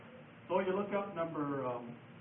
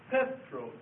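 PETROS with the first syllable higher and stronger; stress on first syllable; 6 semitones higher than usual. Shown as a demonstration. Teacher showing students how Petros is pronounced, first-syllable stress. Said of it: "here's how you would pronounce it".

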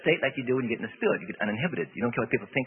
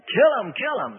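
a higher, louder crowd yell; mock yelling; 8 semitones higher, 8 dB louder than usual. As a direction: bark it like a rowdy fan. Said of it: "They're either, either yelling".

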